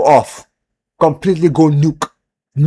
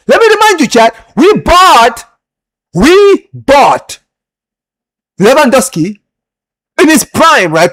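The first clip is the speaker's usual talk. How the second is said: heated rant; 8 semitones higher, 6 dB louder, slower than usual. Real, not acted. Ranting at chat.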